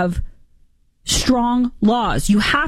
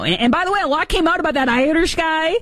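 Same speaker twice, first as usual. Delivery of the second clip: ordinary talk, nothing marked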